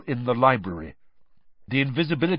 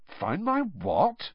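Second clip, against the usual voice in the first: confused; 6 semitones higher, 4 dB quieter, much slower than usual. Acted. Harry confused.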